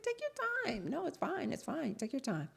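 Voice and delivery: high, soft, overly sweet reassurance; high sugary politeness. Retold or imitated. Telling the contractor to take his time, high and sugary. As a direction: sound falsely pleasant to the guest.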